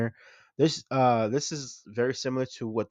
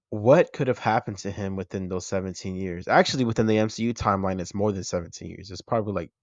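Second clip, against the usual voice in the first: ordinary talk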